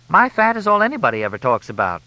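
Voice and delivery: high-voiced grievance; aggrieved complaint. A narrator's reading. Crystal complains in the quarrel.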